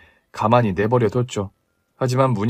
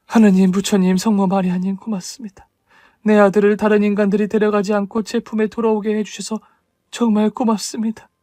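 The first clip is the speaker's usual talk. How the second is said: grateful prayer; 7 semitones higher than usual. Acted. Mother thanking gods, emotional.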